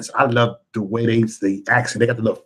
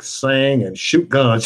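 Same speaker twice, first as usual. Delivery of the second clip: ordinary delivery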